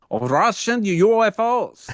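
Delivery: slow, high, hammed-up Russian accent; terrible fake Russian accent; awful Russian accent. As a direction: ham up a bad Russian accent, drawn out. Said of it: "That was an awful Russian accent".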